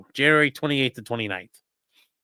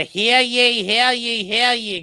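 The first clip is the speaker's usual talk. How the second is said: mock Boston crier; 9 semitones higher, 3 dB louder, slower than usual. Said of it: "everybody else has an awful accent".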